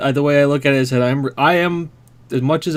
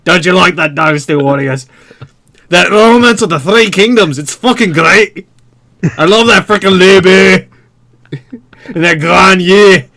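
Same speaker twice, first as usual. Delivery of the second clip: loud, hammed-up Scottish accent; a fake Scottish accent shouting a game pitch; awkward Scottish accent; 3 semitones higher, 5 dB louder than usual. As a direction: play it as a pushy Scottish salesman. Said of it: "a very awkward Scottish guy".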